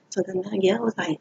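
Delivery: ordinary delivery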